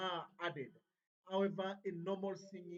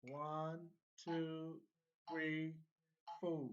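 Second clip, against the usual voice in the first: metronome count; 3 semitones lower, 4 dB quieter, much slower than usual. Shown as a demonstration. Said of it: "If we proceed with our metronome".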